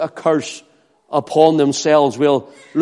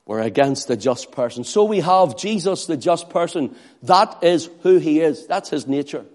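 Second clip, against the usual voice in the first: ordinary talk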